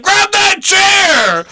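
high, slow mock shout; hammed-up yelled command. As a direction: bark the order like a bossy host. Imitating boss yelling order.

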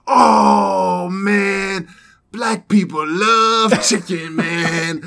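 high, loud excited imitation of the guy; imitating the Iraqi guy. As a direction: crank it up like the eager young guy. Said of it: "And he's like".

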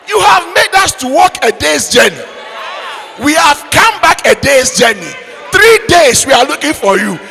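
loud, high scolding mother; scolding African mother. Acted. Angry African mother shouting at her runaway son. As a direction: tear into the boy like an angry mother.

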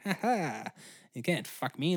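normal talking voice

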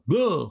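BLEU blurted long and loud; exaggerated French misreading. Shown as a demonstration. Repeating the mistaken French word, loud swooping BLEU. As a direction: swoop high on a drawn-out BLEU. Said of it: "you might think it's more of".

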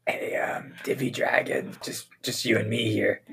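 whispered and hushed, secret aside; conspiratorial whisper. Acted. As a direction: lean in and whisper so nobody else hears.

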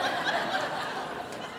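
quiet breathy laugh; soft breathy laugh. Real, not acted. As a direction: chuckle at the memory. Laughing at the story.

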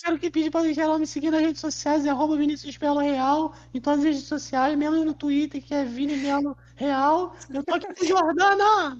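squeaky high voice; chipmunk voice. Pretend. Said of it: "bora encerrar com a voz fina".

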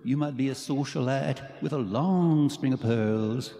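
loud, drawn-out swooping parody of a singer; hammed-up bad singer impression, high and loud. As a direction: exaggerate the swoops and stretch the line endings. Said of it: "do a [name] impersonation".

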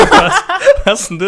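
high, loud laughing disbelief; cracking up while asking; laughing through the question. Real, not acted. Laughing at the Aussie joke.

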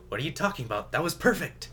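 lightly acted protest, rising high at the end; a hint of an over-pleased director; oblivious director. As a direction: brush off the doubt, voice lifting. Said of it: "he'd just be like".

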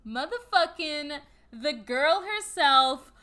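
hype build-up. Pretend. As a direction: build it up like an announcer. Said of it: "You know I love to build it up".

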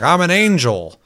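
high and loud, hammed-up proclamation; mock grand angel. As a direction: announce it like an overdramatic game angel. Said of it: "someone's like".

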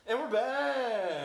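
high, loud, very slow show host call; hammed-up host shout; announcer return. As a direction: drag it out like a big radio comeback. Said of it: "podcast return announcement".

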